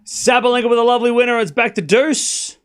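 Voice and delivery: high, loud call of the point; excited play-by-play. Real, not acted. Commentator calls Sabalenka's winner to listeners, excited. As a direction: call the winner with a lift in pitch and volume. Live call of a winning shot.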